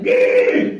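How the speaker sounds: very high shrill yelp; crazy yelp. Retold or imitated. Stranger on the subway, a very high yelp. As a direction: let out a piercing high squeal. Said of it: "I heard it again".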